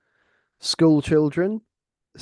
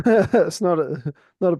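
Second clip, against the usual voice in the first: laughing through the words, high and slow; amused, laughing reassurance; chuckling it off; 5 semitones higher, 6 dB louder than usual. Real, not acted. Laughing at headphone thief interruption.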